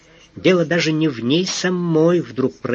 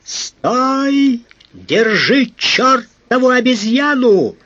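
much higher, louder desperate yelling; frantic roar; 10 semitones higher, 4 dB louder, much slower than usual. A narrator's reading. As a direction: bellow after a fleeing thief. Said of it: "истошный рёв".

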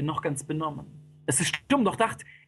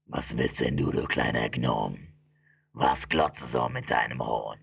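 deep, threatening fiend; menacing demon. Acted. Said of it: "starrt den Dämon an".

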